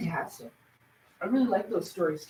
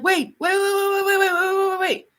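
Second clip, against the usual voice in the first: higher rapid Scottish repeated wait; Scottish guy impression; 10 semitones higher, 3 dB louder than usual. Quoting the Scottish character from Uncharted, Scottish accent. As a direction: fire off the waits like a Scottish guy. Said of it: "the really Scottish guy".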